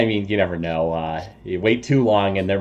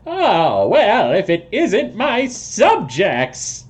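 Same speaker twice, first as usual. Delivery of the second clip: high, drawn-out self-important toad voice; toad person; 11 semitones higher, 3 dB louder, slower than usual. Acted. Toad person receiving his visitors, grandly high-pitched. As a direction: greet them like a pompous toad king, high and slow. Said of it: "He says".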